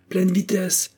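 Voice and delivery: high-pitched call for speed; shouted command. A narrator's reading. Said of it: "cria Girton". (commandement crié)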